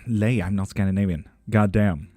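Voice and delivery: ordinary talk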